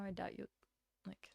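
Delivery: ordinary talk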